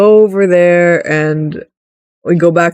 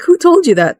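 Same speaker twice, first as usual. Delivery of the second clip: sharp high question; 11 semitones higher than usual. Retold or imitated. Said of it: "It's like".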